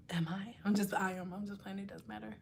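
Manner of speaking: very low, barely audible muttering; muttered second thoughts. Pretend. Streamer second-guessing the joke to herself, muttered. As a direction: mumble it to yourself.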